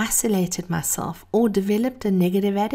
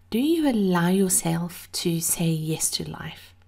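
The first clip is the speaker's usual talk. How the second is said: breathy vintage purr. Real, not acted.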